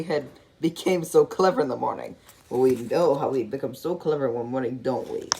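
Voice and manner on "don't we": plain reading voice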